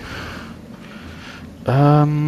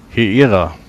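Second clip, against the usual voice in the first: loud call into the room; 6 dB louder than usual. Acted. Player character calls to the servants, loud call. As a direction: call out to get attention.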